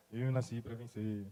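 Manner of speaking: half-hearted, deflated repeat; glum mumble. Retold or imitated. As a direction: drag the words out like a sulky kid.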